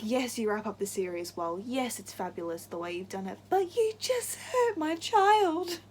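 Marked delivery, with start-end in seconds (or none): 3.5-5.8 much higher and louder, slow anguished wail; upset outburst. Real, not acted. Fan scolding author Cassandra Clare, slow anguished cry. Ranting at the author over a character.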